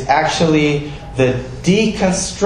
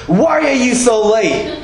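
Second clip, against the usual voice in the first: high, loud, accusing snap at the guest; mock-irritated host at the door; annoyed reproach; 11 semitones higher, 4 dB louder than usual. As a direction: scold him for being late. Imagined rude door greeting.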